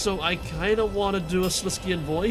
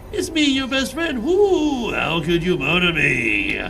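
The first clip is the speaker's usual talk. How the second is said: ghostly wail; 5 dB louder, slower than usual. Acted. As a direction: wail like a ghost then laugh. Shadow voice pretending to be ghost.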